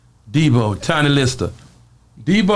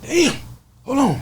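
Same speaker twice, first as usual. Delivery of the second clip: high breathless damn; winded Debo; 12 semitones higher, slower than usual. Retold or imitated. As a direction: gasp it out, knocked flat. Debo winded after slam.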